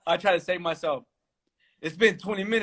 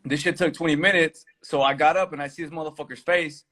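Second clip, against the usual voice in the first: normal talking voice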